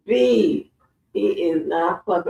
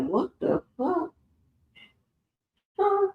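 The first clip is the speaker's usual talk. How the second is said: shocked; 12 semitones higher, 5 dB quieter than usual. Pretend. Imagined shocked reaction.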